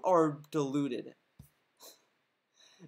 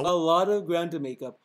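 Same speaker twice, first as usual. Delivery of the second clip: louder mocking parrot of a droning streamer; mocking imitation; 6 dB louder than usual. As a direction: throw his own line back at him with a sneer. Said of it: "Who talks like this?".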